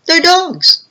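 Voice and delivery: high-pitched snappy reply; mock squeaky retort. Dim partner answers boss, high and snappy. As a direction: squeak it back like the obvious. Harvey snaps back at Vernon.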